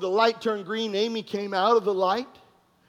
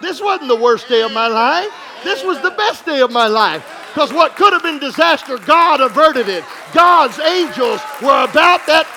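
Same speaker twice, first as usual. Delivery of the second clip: louder, higher, exultant declaration; joyful testimony; 5 semitones higher, 6 dB louder than usual. Retold or imitated. Pastor telling the officer, loud and exultant. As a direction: proclaim the best day. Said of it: "I told him".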